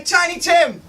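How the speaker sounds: hype-man call to the audience; call-and-response shout. Real, not acted. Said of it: "repeat after me".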